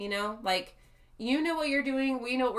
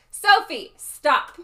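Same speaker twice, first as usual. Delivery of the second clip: firm call; 5 semitones higher, 10 dB louder, much slower than usual. Real, not acted. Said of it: "Gotta get my teacher voice in".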